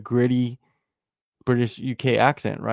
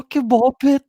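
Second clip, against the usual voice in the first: high, loud mock insult; hammed-up high-pitched name-calling; imitating Darren Till; 12 semitones higher, 4 dB louder than usual. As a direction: mimic an indignant fighter's outburst, pitched way up. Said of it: "Yeah, it's like".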